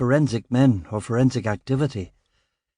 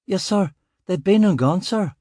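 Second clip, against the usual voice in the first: put-on higher, anxious young constable apologising; rattled junior officer, eager to please; flustered young constable; 7 semitones higher than usual. Constable excusing himself to his inspector, higher nervous voice.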